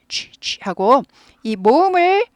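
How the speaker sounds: normal talking voice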